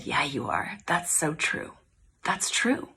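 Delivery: a soft whispered affirmation; tender whisper. Real, not acted. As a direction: whisper it tenderly to reassure her.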